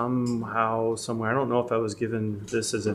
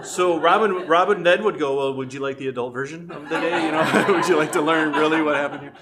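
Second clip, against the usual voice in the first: laughing; 4 semitones higher, 5 dB louder than usual. Real, not acted. Laughing while retelling.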